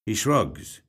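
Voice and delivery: usual speaking voice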